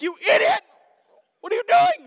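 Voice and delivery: high, loud shout at himself; self-scolding yell. Retold or imitated. Pastor retelling scolding himself after kicking car, loud angry shout. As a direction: yell at your own stupidity. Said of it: "I'm like".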